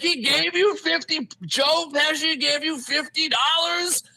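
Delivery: mock-outraged, high-pitched question; retold anger, pitched high; mad uncle. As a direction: imitate an indignant uncle in disbelief. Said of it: "He was all, like, mad".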